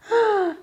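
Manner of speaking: sharp high gasp for air; drowning gasp. Retold or imitated. Reliving the drowning in hell, a sharp gasp. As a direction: suck in air like you are drowning. No podía respirar.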